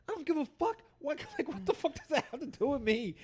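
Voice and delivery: high-pitched incredulous rant with a laugh breaking in; exasperated outburst retold. Reacting to the cop's school lecture, high exasperated outburst.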